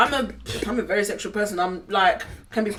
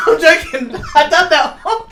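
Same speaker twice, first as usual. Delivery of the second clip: talking through laughter; laughing; 6 semitones higher, slower than usual. Real, not acted. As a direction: laugh while joking. Laughing fit after joke.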